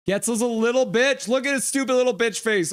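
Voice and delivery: mock the player in a high jeering voice; mocking insult. Streamer jeering at player after cross-check, high sneer.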